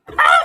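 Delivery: piercing high shriek of fright; high scream. A narrator's reading. Wife feeling frog in bed, high scream. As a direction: shriek at something slimy. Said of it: "She screamed".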